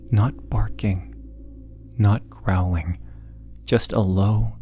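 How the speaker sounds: usual speaking voice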